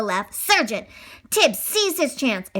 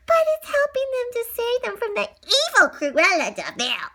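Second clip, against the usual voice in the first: gleeful puppy; 11 semitones higher than usual. Acted. Puppy delighted at dirt.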